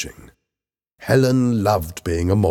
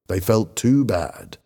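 usual speaking voice

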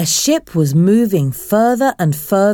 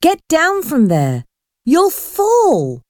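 alarmed warning; 9 semitones higher, slower than usual. Acted. Warning girl on ship rail.

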